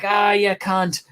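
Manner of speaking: slow, high mock drunk drawl; lightly voiced drunk Irish bloke; imitating the groper shrugging it off; drunk Irishman. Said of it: "then he's like".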